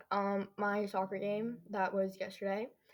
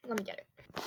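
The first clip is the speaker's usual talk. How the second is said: normal talking voice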